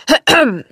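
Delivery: short, formal throat-clearing. A narrator's reading. Butler in the doorway seeks attention, clearing his throat. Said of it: "räusperte er sich".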